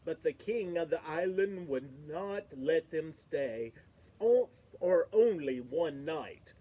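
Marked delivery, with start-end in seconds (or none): none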